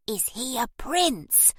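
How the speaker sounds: slow, hoarse little whisper. A narrator's reading. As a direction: rasp it out in a whisper. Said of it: "Lucy whispered hoarsely".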